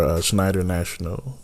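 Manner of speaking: ordinary talk, nothing marked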